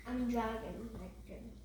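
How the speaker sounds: ordinary delivery, nothing marked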